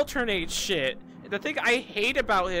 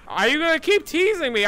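normal talking voice

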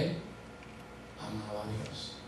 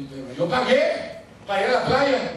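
indignant protest; 9 semitones higher, 12 dB louder than usual. Retold or imitated. Protesting teen.

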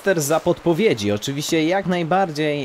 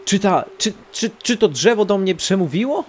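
mock startled, higher and louder; surprised; 8 semitones higher, 4 dB louder than usual. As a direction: play it shocked. Talking tree addresses him.

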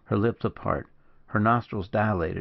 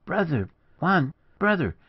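high, urgent calls to a brother; impassioned greeting with a pleading edge; exclaiming; 8 semitones higher than usual. A narrator's reading. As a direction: cry each name out high, drawing it out. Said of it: "exclaimed Margarita".

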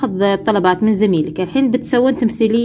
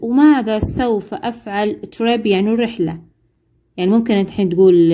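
ordinary talk

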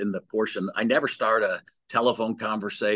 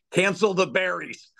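dry, pitched-up cancel order; deadpan joke; 7 semitones higher than usual. Retold or imitated. Dad jokingly cancels client's order to the waiter, deadpan. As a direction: toss it off with a straight face. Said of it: "my dad looks at the waiter and says".

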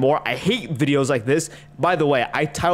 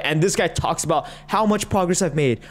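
ordinary talk, nothing marked

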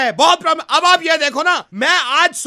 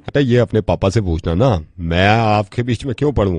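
much lower, gruff prank-caller voice; deep put-on voice. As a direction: play a deep-voiced grown man scolding a kid.

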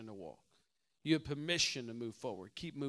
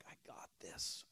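hushed, confident whisper; whispered self-talk; 14 dB quieter than usual. Retold or imitated. Player psyching himself up as the serve comes, whispered. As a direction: tell yourself quietly you've got it. Soft serve coming at him.

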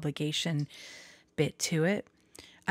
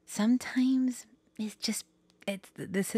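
whisper; 6 semitones higher, slower than usual. Real, not acted. Whispered confiding aside.

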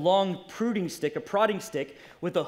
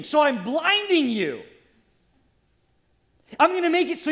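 high, forceful pleading as God; impassioned God; 8 semitones higher, 5 dB louder than usual. Acted. God to Saul, trying to reach him, urgent and impassioned. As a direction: thunder it from the pulpit as God's appeal.

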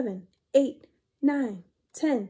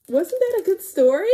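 higher, louder happy question; cheery wrap-up; 7 semitones higher, 8 dB louder than usual. Real, not acted. Reader asking children if they liked it, cheerful.

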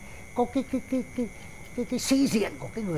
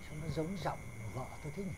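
much softer, fast muttered aside; quick aside; 4 semitones lower, 13 dB quieter, much faster than usual. Acted. Father muttering a quick, soft aside to his son-in-law. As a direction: drop it low and quick as a sly aside.

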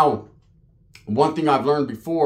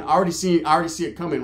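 usual speaking voice

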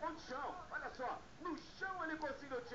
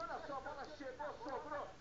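ordinary talk

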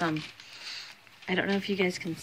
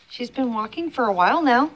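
ordinary talk, nothing marked

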